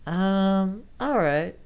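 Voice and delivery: high, slow uncertain um; hesitate, thinking; hesitant female voice. Acted. Amy puzzled by John's test, slow hesitation. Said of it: "she said slowly".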